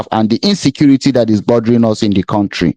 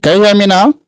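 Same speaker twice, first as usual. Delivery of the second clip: high, raised calling voice; across the street; 7 semitones higher, faster than usual. Real, not acted. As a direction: call out to a lost caller. Caller can't hear host.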